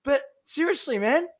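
high-pitched, incredulous pleading; exasperated mock scolding. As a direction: tell off a mate in a high voice. Mock scolding of absent teammate.

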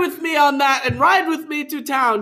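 high, loud proclamation; hammed-up. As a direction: boom it out grandly. Hammed-up reading.

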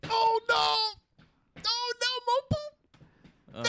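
high, slow squealing panic; panicked. Retold or imitated. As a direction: squeal in disgusted alarm. Reenacting stepping in poop.

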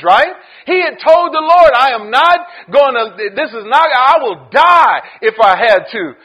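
loud storytelling sermon; fired-up preaching. Acted. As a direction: drive the story home to the crowd. Retelling Peter at full force.

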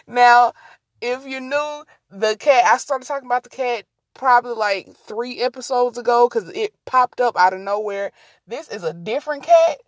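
ordinary talk

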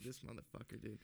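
soft muttered curse; under-breath mutter. Retold or imitated. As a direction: grumble it under your breath. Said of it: "'cause I'm whispering under my breath".

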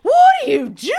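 high, loud baffled yell; drunk shout. Retold or imitated. Drunk husband yells at her, high loud shout. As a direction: bellow it in drunken confusion. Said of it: "He's like".

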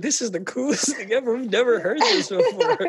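put-on high, loud, excited retelling of kids' awe; childlike amazement. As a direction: marvel like a thrilled kid, breaking into laughter. Kids awed by the accents, retold.

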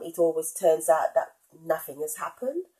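normal talking voice

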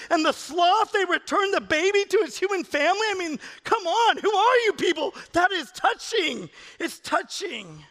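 high, worked-up comic protest; indignant dad. Retold or imitated. Dad defending his tears at Ice Age to his kids, indignant. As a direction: plead your case, high-pitched and overwrought.

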